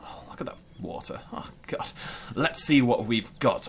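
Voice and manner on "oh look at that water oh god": breathless whisper of awe; hushed, wowed whisper; awed whisper. Real, not acted. Awed at island water.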